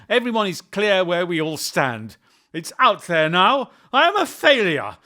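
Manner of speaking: loud, high, bitter shout; angry outburst. Acted. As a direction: blurt it out in fury. Son's outburst at mother.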